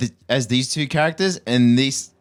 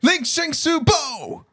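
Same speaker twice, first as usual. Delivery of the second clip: mock character voice; much slower than usual. Mock character name shout.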